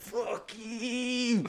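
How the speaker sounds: high, strained, hushed mock curse; mock pissed-off. Driver answering the honking cars behind him, comic anger. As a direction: squeeze it out high and small, acting pissed. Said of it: "I'm getting pissed".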